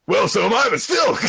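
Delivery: squeaky mock-outraged monkey chatter. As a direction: shout it comically high, like a jeering monkey. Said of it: "Which monkey tribe?".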